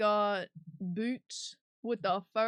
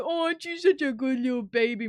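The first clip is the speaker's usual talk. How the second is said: put-on high, loud cooing baby talk to a grown man; doting baby talk; 4 semitones higher, 10 dB louder than usual. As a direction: coo at him like he's a baby. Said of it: "He'd be like".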